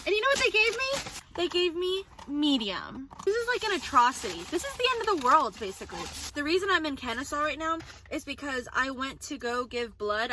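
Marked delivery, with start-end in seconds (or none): 0.1-5.6 mock outrage. Real, not acted. Mock outrage over wrong sauce.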